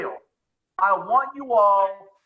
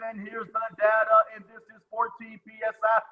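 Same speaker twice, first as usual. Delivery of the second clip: even pitch, measured drone; dad's low-energy voice. Retold or imitated. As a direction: imitate a dad who is never upbeat. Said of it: "is not an upbeat kind of guy".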